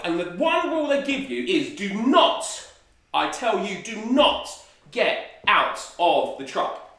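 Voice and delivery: "do not" punched loud, "get out" slowed; emphatic warning. Acted. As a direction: hammer home "do not get out". Stressing the safari rule.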